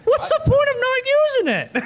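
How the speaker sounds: very high-pitched comic protest; mock outraged cry. Real, not acted. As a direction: cry out in playful disbelief. Mock outrage over not yelling objection.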